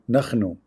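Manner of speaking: nahnu said naxnu with a throaty kh; Hebrew pronunciation. Shown as a demonstration. Contrasting Arabic and Hebrew, nahnu with a throaty kh. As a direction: scrape the h in nahnu. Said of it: "In Hebrew".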